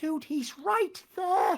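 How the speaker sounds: high, slow, strained cry of disbelief; stunned viewer yelling at the screen; exasperated disbelief. Retold or imitated. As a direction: drag it out as if you can't believe it. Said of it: "you're just thinking".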